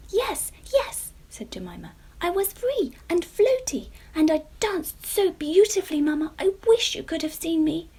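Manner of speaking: high, loud dreamy gushing; excited child. A narrator's reading. Jemima telling mamma how she danced, high and excited. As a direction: gush about the dance.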